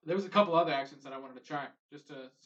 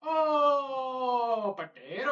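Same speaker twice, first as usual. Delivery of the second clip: high mock Irish cry of potato; shouted Irish accent; 8 semitones higher, much slower than usual. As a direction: belt out a hammed-up Irish Oh, potato. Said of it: "give me a good one".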